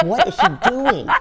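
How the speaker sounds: high-pitched imitation of baffled onlookers; half-laughing, high mock disbelief; mock bewilderment. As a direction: imitate an incredulous crew, voice pitched right up. Crew reacting to her antics.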